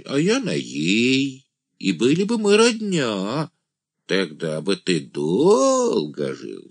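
очень высокий, тягучий голос юродивого; растягивай слова высоко, увлекаясь мечтой; блаженный «ангел», размечтался. A narrator's reading. (юродивый жених, нараспев)

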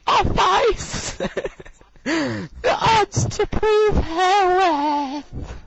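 very high slow squeaky reading, breaking into laughs; high mock voice cracking with laughter; Beverly impression with giggles. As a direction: keep the squeaky voice while giggling through it. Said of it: "I can't do it without laughing".

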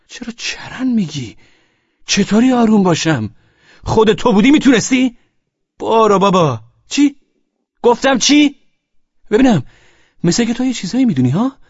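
high, loud, indignant challenge; agitated quarrel. Acted. Husband challenges friend Amir on phone, agitated quarrel.

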